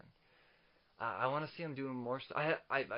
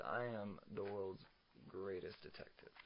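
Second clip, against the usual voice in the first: Batman quote; 4 semitones lower, 9 dB quieter than usual. Acted. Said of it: "And Batman says".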